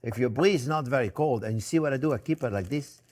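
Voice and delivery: normal talking voice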